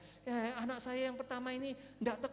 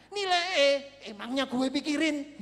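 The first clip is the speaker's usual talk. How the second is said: very loud, high punchline; comic defiance; 6 semitones higher, 13 dB louder than usual. Real, not acted. Preacher shrugs off a failing grade, loud comic defiance. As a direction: belt out the carefree punchline.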